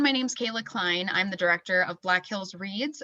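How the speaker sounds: ordinary talk, nothing marked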